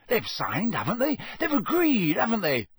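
hearty, swooping character voice; animated persuasion rising in pitch; jovial Bagman, insistent. Acted. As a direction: argue the objection away cheerfully.

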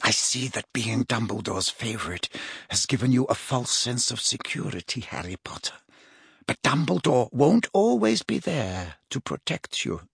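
soft, silky menace; unpleasantly smug. A narrator's reading. Mother taunting Harry Potter, softly menacing. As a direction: threaten quietly through an unpleasant smile. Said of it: "smiled unpleasantly".

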